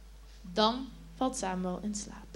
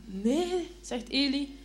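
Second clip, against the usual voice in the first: put-on sleepy old man; 6 semitones higher than usual. A narrator's reading. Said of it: "Eli kijkt slaperig".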